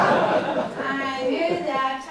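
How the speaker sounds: bursts out laughing, then drawls the words slowly. Real, not acted. Professor laughing at her own fireside queen joke, laughing. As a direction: laugh hard through a slowed-down phrase.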